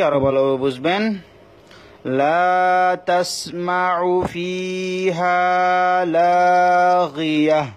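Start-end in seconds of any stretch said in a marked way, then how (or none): 2.2-7.7 slow, drawn-out melodic Quran chant; slow chanted Quranic Arabic for a tajweed class; Quran recitation. Shown as a demonstration. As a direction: recite it slowly with tajweed and stop on the last word. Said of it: "আর একটা উদাহরণ দিচ্ছি".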